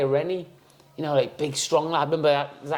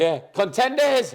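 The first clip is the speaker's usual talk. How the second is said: high, loud Scottish announcer bellow; Scottish Gladiators call; 9 semitones higher, 3 dB louder, slower than usual. Retold or imitated. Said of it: "doing the Scottish accent".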